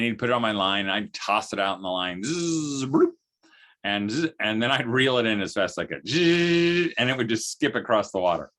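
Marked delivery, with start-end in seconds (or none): 2.2-3.1 a buzzing zzz then a popped bloop; cast and splash noise. Retold or imitated. Telling kids how he cast, buzz and plop. 6.1-6.9 higher, louder buzzing zzz; reel whirr noise. Retold or imitated. Telling how he reeled in fast, higher buzzing whirr.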